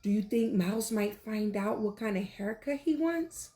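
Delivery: ordinary delivery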